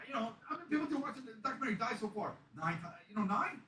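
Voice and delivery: high, hurried mock crying; imitating a tearful breakdown. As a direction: blurt it out like someone breaking down. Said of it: "she hugs me and just breaks down".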